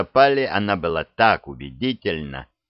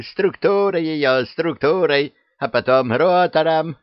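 higher, louder excited babble; witch parroting; 8 semitones higher, 4 dB louder than usual. Acted. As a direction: parrot words like a giddy hag. Witch mimicking clever words. (ведьма повторяет)

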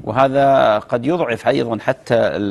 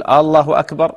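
higher questioning الله أكبر with a lengthened first "aa"; raised pitch on الله أكبر, opening with an interrogative "aa"; as if asking; 4 semitones higher, 4 dB louder than usual. Shown as a demonstration. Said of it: "كأنه يستفهم".

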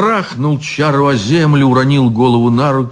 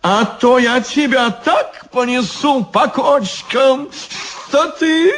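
squeaky threat; 12 semitones higher than usual. Acted. Devil threatening Ivan.

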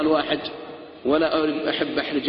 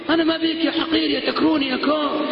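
mock insulting wife; 11 semitones higher than usual.

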